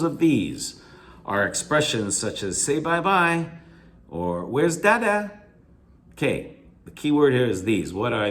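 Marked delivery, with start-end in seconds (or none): 2.6-3.5 high sing-song lilt on "say bye-bye"; "say bye-bye" in exaggerated caretaker lilt, pitched up. Shown as a demonstration. Teacher quoting baby talk to students, sing-song. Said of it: "in sing-song inflections". 4.4-5.4 high sing-song lilt on "where's dada"; "where's dada" pitched way up like baby talk. Shown as a demonstration. Teacher quoting what parents ask toddlers, sing-song. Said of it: "in sing-song inflections".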